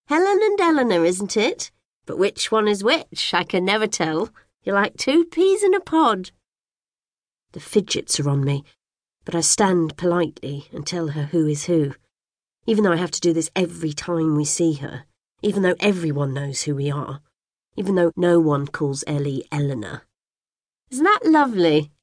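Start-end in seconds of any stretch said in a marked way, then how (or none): 0.1-6.3 put-on high, fluttery old lady voice; fussy neighbour. A narrator's reading. Old neighbour greeting the twins in a high, gushing voice. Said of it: "she says". 20.9-21.9 put-on high, fluttery old lady voice; fussy neighbour. A narrator's reading. Old neighbour greeting the twins in a high, gushing voice. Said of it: "says Missus Dunkerley".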